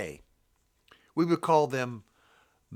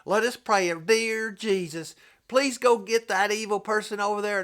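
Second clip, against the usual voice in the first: whiny prayer; 7 semitones higher, 3 dB louder than usual. Retold or imitated. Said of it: "I hear this a million times from Christians".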